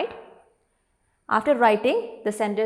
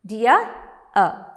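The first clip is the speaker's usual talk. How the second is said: "Dear-er" pulled apart into two beats; syllable by syllable; 5 dB louder than usual. Shown as a demonstration. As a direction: say "Dear", pause, then "er". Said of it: "There are two syllables".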